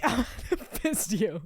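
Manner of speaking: real laughter breaking into the words; laughing. Real, not acted. Friend echoing an accidental innuendo, laughing. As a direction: repeat it through helpless giggles.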